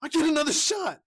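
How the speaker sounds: high, loud proclamation; triumphant Robin. Acted. Co-host as Robin reading the script, loud triumphant announcement. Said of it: "he was like".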